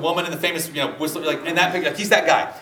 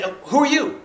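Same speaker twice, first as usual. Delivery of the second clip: higher, brisk mock clerk voice; hell receptionist; 6 semitones higher than usual. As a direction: play a chirpy receptionist. Clerk in hell's waiting room.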